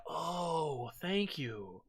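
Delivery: soft, low sigh of thanks; hushed grateful relief. Retold or imitated. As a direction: breathe out mock relief, very softly. Acted relief on seeing the show.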